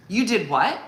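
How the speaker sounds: hammed-up suspicious mother; mock aggressive. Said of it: "real aggressive".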